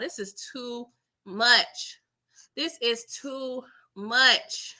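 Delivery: slowed down, dragged out; slow, weary. Retold or imitated. Telling the show it is too much, slow and weary. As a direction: drag it out, overwhelmed. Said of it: "I was just like".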